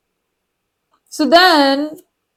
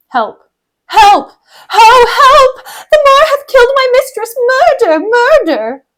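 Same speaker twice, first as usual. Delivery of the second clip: high, loud theatrical cries; dramatic high-pitched cry for help; shouting for help; 10 semitones higher, 4 dB louder than usual. Acted. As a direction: raise the alarm at a murder. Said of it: "like, shouting for help".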